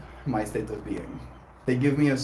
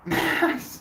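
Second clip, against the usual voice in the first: high burst of laughter; sudden high laugh. Real, not acted. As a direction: let out a high-pitched laugh. Laughs at chat on stream.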